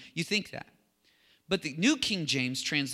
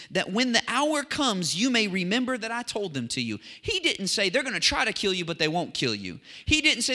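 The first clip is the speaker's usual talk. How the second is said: raised, higher preaching voice; fervent preaching; 6 semitones higher, 6 dB louder than usual. Real, not acted. Preacher explains what Jesus said to his followers, fervent preaching. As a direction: drive the teaching home like a preacher.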